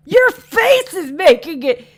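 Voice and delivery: loud, slow laughing yell; laughing shout. Real, not acted. Laughing, shouting at friend's joke.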